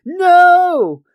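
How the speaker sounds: high, loud, drawn-out cry; alarmed shout. Acted. Three guys stop Homer drinking salt water, alarmed shout. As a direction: yell to stop someone. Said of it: "have to stop him".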